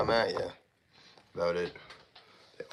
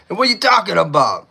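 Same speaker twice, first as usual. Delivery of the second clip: high, loud New York wiseguy bit; mock New York accent; 10 semitones higher, 10 dB louder than usual. Streamer joking he sounds East Coast, mock New York. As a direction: throw it out in hammed-up New York. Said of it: "I sound like Boston or New York".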